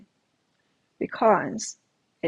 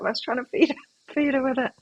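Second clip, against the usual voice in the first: ordinary talk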